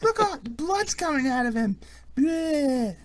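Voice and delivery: put-on high-pitched child squeal; gleeful little kid. Kid dancing around the pretend corpse, gleeful. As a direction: squeak it with play-acted gore glee.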